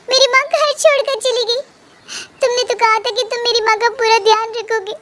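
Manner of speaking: high, upset voice close to tears; distressed tearful cry. Acted. Madhu tells her husband her mother has left, tearful and distraught. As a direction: call out in panic and grief.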